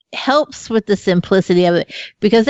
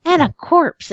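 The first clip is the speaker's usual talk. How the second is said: higher, dramatic true-crime narration; true crime narrator; 12 semitones higher than usual. Retold or imitated. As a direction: ham up a crime documentary voice. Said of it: "they're like".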